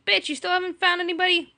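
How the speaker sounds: high, smug mocking jab; taunting. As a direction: gloat at an old rival. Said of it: "be all like".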